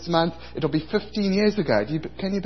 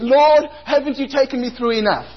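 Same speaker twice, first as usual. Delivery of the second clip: high, loud, exasperated plea to the Lord; high pitched exasperated protest; indignant complaint; 7 semitones higher, 3 dB louder than usual. Retold or imitated. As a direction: whine at God in outrage. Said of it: "I was enraged".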